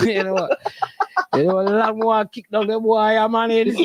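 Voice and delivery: ordinary talk, nothing marked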